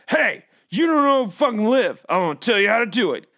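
mock aggressive self-help guru; aggressive lecturing. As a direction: bark life advice at the listener. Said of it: "such an aggressive".

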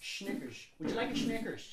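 mock Dutch accent with SH in "Snickers". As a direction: play a Dutch speaker offering a treat. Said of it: "listening to Dutch people who talk".

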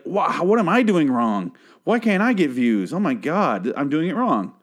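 high-pitched, self-mocking lament; mock exasperation. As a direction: wail it in mock despair. Acts out own envy over views.

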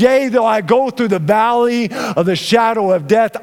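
ringing, lifted scripture proclamation; preaching psalm. Acted. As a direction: proclaim it over the congregation.

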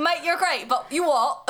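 put-on Chav accent. Playfully squaring up to a friend, Chav accent. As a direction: turn matey, then challenge in a mock Chav accent. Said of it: "I'm good at a frickin' Chav accent".

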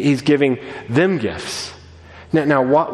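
ordinary talk

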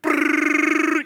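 high, loud mock engine sputter; playful motor noise for the crowd; imitated sputtering motor. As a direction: buzz your lips like a dead outboard. Stalled boat motor imitated.

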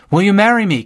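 joyful proposal yelled over the speakerphone. A narrator's reading. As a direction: shout the question for everyone. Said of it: "Kate yelled".

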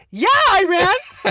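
high, loud, slow mock answer; high hammed-up own younger self; cocky retort. Retold cocky reply to dad.

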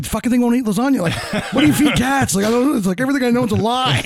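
giggling at his own confusion; laughing. Real, not acted. Man laughing about his cat's diet to friends. Laughing at cat not eating lasagna.

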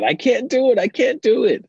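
put-on high, loud, frustrated toddler wail; mock child's yell. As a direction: yell it like an upset three-year-old. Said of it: "She starts yelling out".

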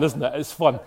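usual speaking voice

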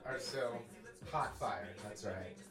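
ordinary delivery

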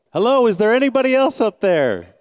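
high, loud desperate shout; loud yell. Acted. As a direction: yell up, hoping for someone else. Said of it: "with a loud voice, yelled".

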